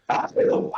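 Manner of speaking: voiceless, exaggerated "ahh"; gasp after downing a drink. Character just downed the drink completely, gasping. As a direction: let out a big unvoiced post-drink exhale. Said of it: "and just goes".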